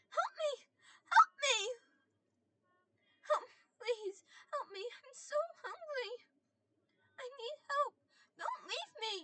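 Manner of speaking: put-on a devil faking a crying child's screams; frantic crying child. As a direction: wail for help like a terrified child. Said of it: "a frantic child on the verge of tears".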